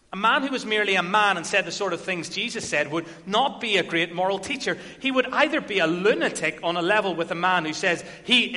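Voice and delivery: loud, forceful preaching. Acted. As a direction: drive it home to the congregation. Preaching a quoted passage at full force.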